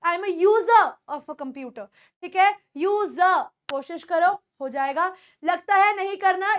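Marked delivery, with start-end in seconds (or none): 0.3-1.9 "user" ending open as "yoo-zuh"; silent R. Shown as a demonstration. Teacher shows user with silent R, non-rhotic. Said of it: "आर को silent कर सकते हो". 2.8-3.4 "यूजर" as "yoo-zuh"; silent R. Shown as a demonstration. Teacher has students try यूजर, silent R. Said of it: "आर को silent कर सकते हो".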